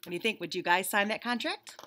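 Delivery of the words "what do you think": ordinary delivery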